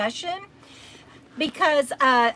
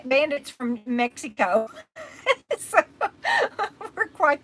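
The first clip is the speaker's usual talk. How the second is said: laughing; 3 semitones higher than usual. Real, not acted. Laughing about international crew.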